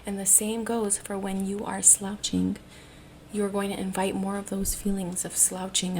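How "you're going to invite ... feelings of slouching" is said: normal talking voice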